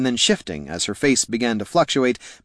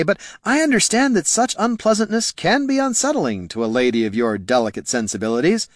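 higher-voiced courtly bard; smooth-talking bard, pitched up then easing down; fawning bard; 8 semitones higher than usual. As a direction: soothe a delicate lady with flowery words. Bard's fawning speech in read fiction.

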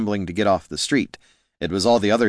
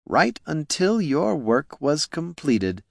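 slow, higher smug villain drawl; 8 semitones higher, slower than usual. Acted. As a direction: stretch out the cruelty. Villain Flynn to stabbed Niln.

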